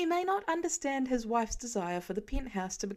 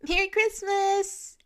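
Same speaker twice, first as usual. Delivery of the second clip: put-on much higher, louder, excited child's voice; little girl; 11 semitones higher, 7 dB louder, slower than usual. A young girl thanking Spider-Man for saving her puppy, high excited child voice. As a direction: squeal it up high like a gap-toothed kid.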